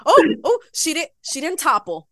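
high, loud startled "oh, oh"; mock shock at her own wobble; startled gasp. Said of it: "one of those, like".

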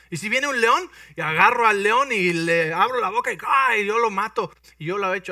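high, loud, thrilled retelling; young David telling his lion fight; excited boast. Acted. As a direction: relive the lion fight loudly. David's lion story climax.

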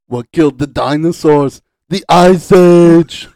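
hammed-up shout, slow on ice age; mock Freeze line. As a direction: bellow it like a cartoon villain. Quoting Mr. Freeze movie line.

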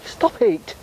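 high small voice pushing others on; high-pitched squeaky voice. Acted. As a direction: squeak at them to keep moving.

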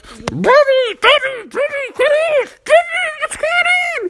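very high squeaky worried calls; tiny animal voices. Acted. Rabbits and squirrels calling to squashed Bubby, high squeak. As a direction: squeak out like little critters calling a friend.

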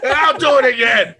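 high, loud mock shouting, laughing through it; hammed-up courtroom showdown. Friend acts out the code red scene, shouting. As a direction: bellow like a cornered colonel. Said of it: "A Few Good Men quote".

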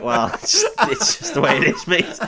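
guard's line said through laughter; laughing while quoting. Retold or imitated. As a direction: giggle through the guard's shrug. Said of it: "And he's just like".

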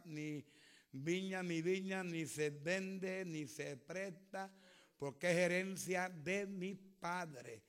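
preacher voicing the prophet turning down Acab, slow and soft; prophet refusing the king. Lightly voiced prophet, unhurried and final. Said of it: "él le dijo a Acab".

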